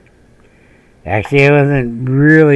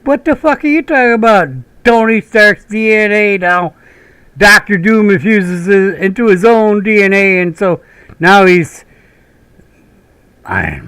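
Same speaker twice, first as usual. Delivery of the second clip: high, loud nerd rant; exasperated rant; 9 semitones higher, 4 dB louder than usual. Retold or imitated. Explaining the plot twist in a high rant. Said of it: "I'm like".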